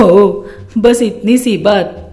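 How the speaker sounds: mother making light of the quarrel; scheming mother, reassuring. Acted. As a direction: soothe and advise in a low pitch. Voices mother reassuring crying daughter.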